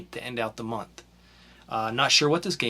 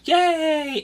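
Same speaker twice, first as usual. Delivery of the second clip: mock cheer; 6 dB louder than usual. Mock cheer over free games.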